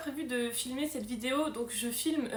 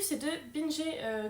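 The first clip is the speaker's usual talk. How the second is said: ordinary delivery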